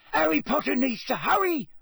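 squeaky, high-pitched house-elf urging; Dobby. A narrator's reading. Elf waking Harry in the library, squeaking urgently. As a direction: squeak it urgently, far above normal pitch. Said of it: "squeaked Dobby".

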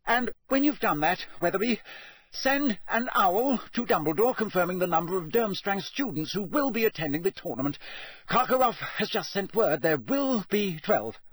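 high, strained voice of a man gone mad; addled ministry boss giving orders to a tree; deranged official. A narrator's reading. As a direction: dictate orders to no one, unhinged. Crouch talking to a tree.

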